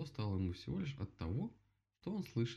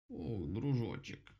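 slow, drawn-out waking cry; startled awake; 3 semitones higher, much slower than usual. Acted. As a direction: jolt awake and drawl it. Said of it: "вдруг очнулась черепаха".

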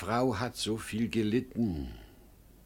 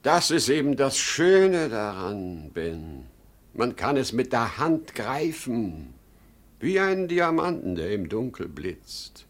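louder and higher, carried away; rapturous excitement; 4 semitones higher, 8 dB louder than usual. Acted. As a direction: get swept up by the dream. Father raves about the money. (begeistert und laut)

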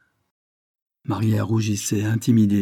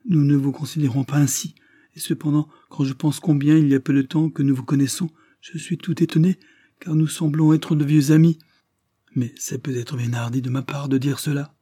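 lightly voiced young woman, pitched higher; timid young woman; 5 semitones higher, 4 dB louder than usual. A narrator's reading. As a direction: raise the voice for a shy young lady. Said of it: "murmura-t-elle". (jeune femme timide, voix plus haute)

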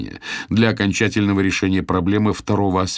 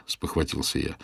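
plain reading voice

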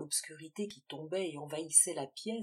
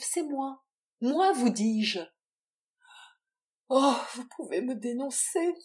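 loud defiant confession; vehement claim; 6 semitones higher, 9 dB louder than usual. Acted. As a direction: hammer it home defiantly. Valentine insists she is the mother.

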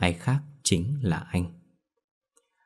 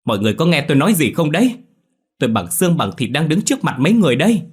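higher, much louder and fast, frantic; desperate scream; 7 semitones higher, 7 dB louder, faster than usual. A narrator's reading. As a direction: scream to be heard by everyone. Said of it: "anh gào lên lần nữa".

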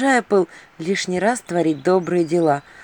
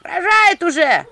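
higher, louder urgent call; shouting; 6 semitones higher, 4 dB louder, much slower than usual. Acted. Villagers shout for the witch, high loud urgent call. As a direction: call the witch urgently, slowing on the last words. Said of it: "кричали мне".

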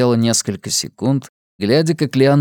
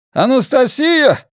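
high drawn-out shout across a platform; calling out; 13 semitones higher, 4 dB louder, slower than usual. A narrator's reading. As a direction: yell the name over the crowd. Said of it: "продолжал взывать мужчина".

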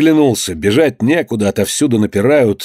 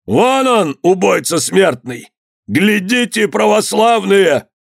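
high, drawn-out accusing cry; raised-pitch public denunciation; 8 semitones higher, slower than usual. A narrator's reading. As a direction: call it out to a whole crowd. Accuser cries out to crowd.

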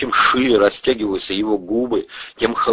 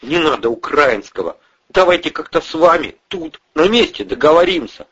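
raised-pitch, anxious bargaining; timid pleading; 8 semitones higher, 3 dB louder than usual. A narrator's reading. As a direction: coax the boss into a deal. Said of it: "робко начал Андрей".

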